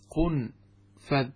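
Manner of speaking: قنفذ as qun-fadh, a after the f; fatha on the fa. Shown as a demonstration. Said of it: "بضم القاف وسكون النون وفتح الفاء".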